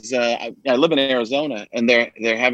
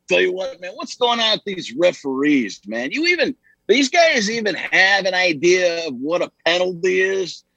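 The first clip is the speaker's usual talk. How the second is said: football coach impersonation, pitched up; John Gruden; 4 semitones higher than usual. Acted. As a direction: rant at the league boss like a coach. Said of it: "[name] becomes John Gruden".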